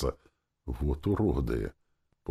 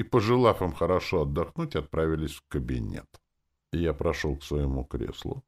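usual speaking voice

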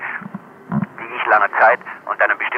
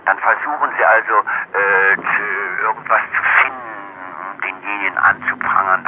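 normal talking voice

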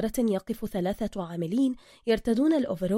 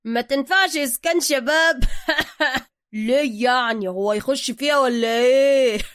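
higher, louder teasing with laughs; mocking boss laughing; 3 semitones higher, 8 dB louder than usual. Zergina mocking the request, higher and louder. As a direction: laugh at your own joke.

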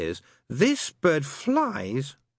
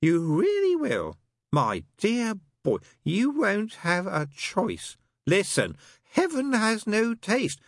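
slow, higher, unrelenting needling; relentless; 12 semitones higher, slower than usual. Acted. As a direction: keep pushing, savouring it. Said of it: "said Crowley relentlessly".

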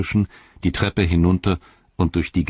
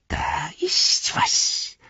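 high slow creature whisper; sniffing the air; much slower than usual. A narrator's reading. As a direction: sniff warily, breathy and high. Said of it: "misstrauisch schnüffelnd". (schnüffelnd geflüstert)

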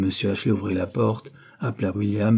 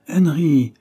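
higher, louder reproachful call of the name; chiding call; 7 semitones higher, 7 dB louder than usual. A narrator's reading. Mother calling her fretting son to order, chiding. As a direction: call the boy to order, voice raised.

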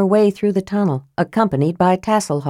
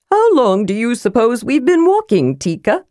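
high, far-from-narrator character voice; high-pitched little adventurer; chatty kender; 5 semitones higher than usual. A narrator's reading. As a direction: play a chatty kender changing the subject.

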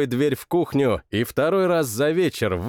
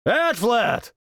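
higher calling shout; calling again; 9 semitones higher than usual. A narrator's reading. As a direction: call out, searching. (зов хозяина)

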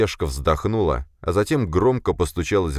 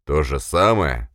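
incredulous echo; slower than usual. Acted. Boss echoes subordinate's excuse, incredulous. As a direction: throw the excuse back slowly, in disbelief.

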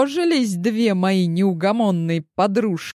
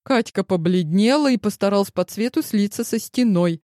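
usual speaking voice

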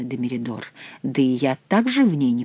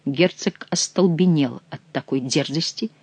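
ordinary delivery, nothing marked

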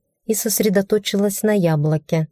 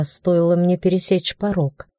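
usual speaking voice

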